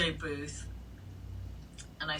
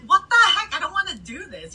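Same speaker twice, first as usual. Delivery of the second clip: much higher, louder "what the heck"; indignant squeal of protest; shocked protest; 12 semitones higher, 4 dB louder than usual. Retold or imitated. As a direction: recoil in disbelief. Dragged into a stall.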